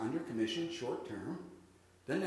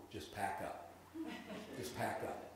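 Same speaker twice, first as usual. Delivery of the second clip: softly, almost under the breath; quiet demo; 4 semitones lower, 11 dB quieter than usual. Shown as a demonstration. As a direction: drop to a hush as if packing up. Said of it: "Can I demonstrate what it looks like?".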